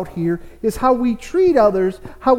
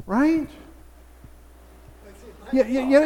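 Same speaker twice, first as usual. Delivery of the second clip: ordinary delivery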